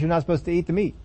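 ordinary talk, nothing marked

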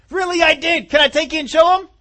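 high, loud, eager protest; pleading insistence. Acted. David begs to show his doubters, pleading insistence.

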